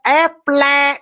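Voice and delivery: high, loud mock duck squawk; Aflac duck quack. Punchline of the duck joke, a squawked Aflac.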